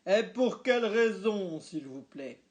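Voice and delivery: loud clipped demand for a reason; stiff demand. A narrator's reading. Dad pressing the waitress, loud and stiff. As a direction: insist on an answer.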